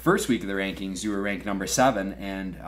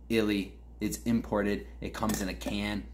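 usual speaking voice

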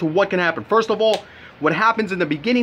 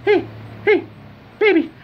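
high, louder falsetto for the wife's flirty ask; imitating the wife; 13 semitones higher, 3 dB louder than usual. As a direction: squeak it out as a coy wife teasing her husband. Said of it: "And she's like".

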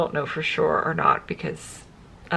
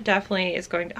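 normal talking voice

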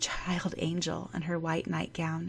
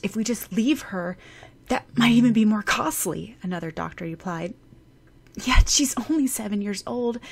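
lightly voiced doctors, louder and a little higher, anxious; two doctors arguing over a hopeless case; worried doctors; 4 semitones higher, 7 dB louder than usual. Doctors debating the girl's case.

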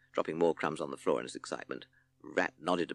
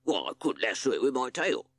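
higher, louder character voice for a rat; boastful rat; 5 semitones higher, 5 dB louder than usual. A narrator's reading. Rat offering the band his tail trick, boastful. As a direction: put on a rat's voice for a proud offer.

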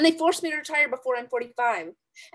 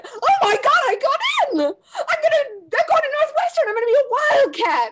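high, loud, half laughing and crying; overjoyed; 13 semitones higher, 7 dB louder than usual. Acted. Girl learning she got into Northwestern, tearful joyful shouting. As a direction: burst out with tearful joy.

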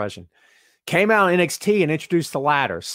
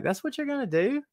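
incredulous; 11 semitones higher, 6 dB quieter than usual. Retold or imitated. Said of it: "we were all kinda like".

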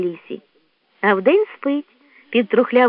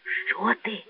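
hushed alarm; 10 dB quieter than usual. Acted. Raccoon fears for the badger.

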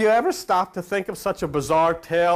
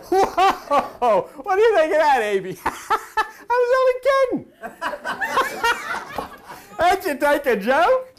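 laughing mocking God; 11 semitones higher than usual. God teases Abe about the joke, high and laughing. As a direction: tease like a prankster who's been caught.

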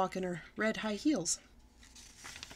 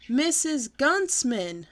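slow, louder, higher holler of the name; 5 semitones higher, 6 dB louder, much slower than usual. Acted. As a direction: shout it so mother finally hears. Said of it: "I hollered".

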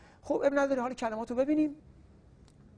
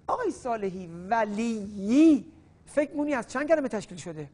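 ordinary delivery

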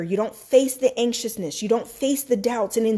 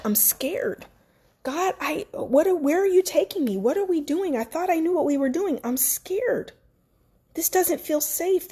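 higher, hushed scared voice; retold fear; 4 semitones higher than usual. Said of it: "I'm like".

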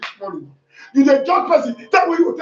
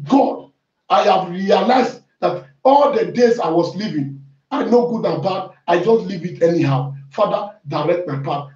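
prayer; 4 semitones lower than usual. Acted. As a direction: pray humbly to God. Leading a prayer.

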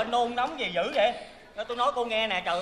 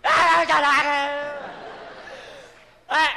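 loud, high mock bawling; demonstrated baby crying; 8 semitones higher, 7 dB louder, much slower than usual. Shown as a demonstration. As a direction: wail like a roaring vacuum cleaner. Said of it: "coi nó khóc nè". (giả khóc oe oe thật to)

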